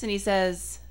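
ordinary talk

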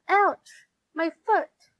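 high, loud yelp of pain; storybook character crying out sharply in pain. A narrator's reading. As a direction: cry out as if just hurt. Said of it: "cried Fern".

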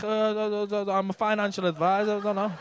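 mock hesitant stammer; imitated nervous stutter on the way in; stuttering imitation. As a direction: stumble over it like someone dodging the question. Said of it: "none of that stuttering off Robbie".

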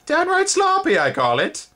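very high, loud tutting insect voice; disapproving insect. A narrator's reading. Second spindlebug grumbling, very high disapproving voice. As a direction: sniff at the sloppiness. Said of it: "said the second".

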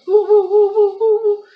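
very high, loud wind howl. Acted. Wind sound while fleeing.

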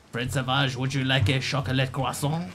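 put-on French politeness; hammed-up French host; mock French accent. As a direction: offer it in pretentious pretend French. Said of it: "as Fred Savage".

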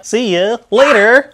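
slow, loud, high call goodbye. Real, not acted. Calling out goodbye to child.

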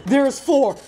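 loud, high cry with the push on four; excited shout. Real, not acted. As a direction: shout it out, thrilled, hitting four. Excited count hits four.

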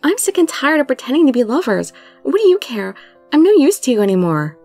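heated argument. A narrator's reading. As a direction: throw it at him, a touch heated. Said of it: "Our voices crashed and roared".

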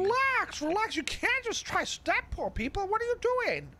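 alarmed. Acted. Cop calming armed man.

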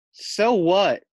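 loud, defiant snapped retort; snarl. Acted. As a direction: throw it back at them, stung and defiant. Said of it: "snarls Lacey".

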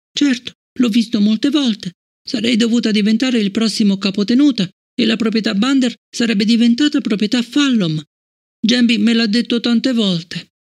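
put-on high young girl's voice, hurt and puzzled; a child asking why she is disliked; child Fallon. Fallon asking Bliss about Travis, high child voice.

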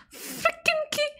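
high, soft whimpered grumble; whiny frustration. Real, not acted. Gamer beaten by a key, small high whine.

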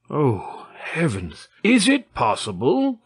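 amazed exclamation leaping high at the end; incredulous, pitch soaring on the question; astonished cry. A narrator's reading. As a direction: cry out in disbelief. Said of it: "cried the commandant".

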